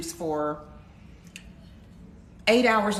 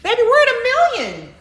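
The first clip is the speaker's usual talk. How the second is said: excited; 12 semitones higher, 8 dB louder, faster than usual. Real, not acted. Reaching a million likes.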